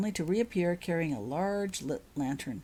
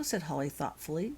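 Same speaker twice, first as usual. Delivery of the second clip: usual speaking voice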